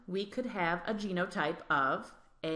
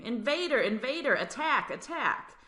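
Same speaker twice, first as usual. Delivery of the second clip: alarmed immune cell; 6 semitones higher than usual. Acted. Immune cells voiced as alarm.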